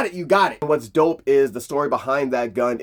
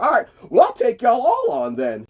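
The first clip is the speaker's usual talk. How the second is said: defiant challenge; 8 semitones higher than usual. Retold or imitated. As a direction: bark it at the whole crew. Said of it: "He's like".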